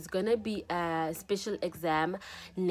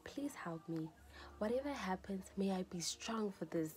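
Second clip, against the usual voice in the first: whispered, pleading prayer under the breath; hushed prayer; 3 semitones lower, 10 dB quieter than usual. Retold or imitated. Student retelling her prayer before exam results, hushed whisper. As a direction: pray quietly for strength, barely voiced.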